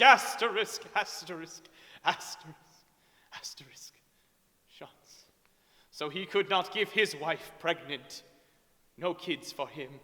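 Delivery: ordinary delivery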